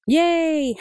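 put-on a high, happy boy's cheer; child cheering. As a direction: cheer like a delighted little boy. Adika cheers.

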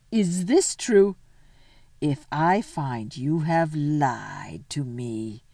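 slow, drawn-out menace; threatening queen. Acted. The queen warns Edmund against lying, slow menacing voice.

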